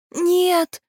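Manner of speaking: high, piping singsong cry; thin little voice. A narrator's reading. Tiny shaggy creature protecting the keener, thin piping cry. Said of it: "пропел тоненький голосок".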